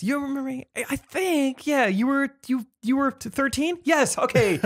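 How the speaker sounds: high, halting voice, groping to remember; hesitant recognition act. Retold or imitated. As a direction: play unsure recognition, pitched high, then relieved. Acted encounter with Thomas.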